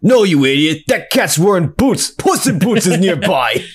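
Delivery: villain boss. Retold or imitated. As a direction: scold the dumb henchman. Villain boss scolding henchman.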